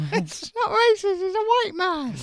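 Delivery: high, slow, nasal-sounding caricature; high put-on voice. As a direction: pitch it way up and drag it out. Still in the put-on voice.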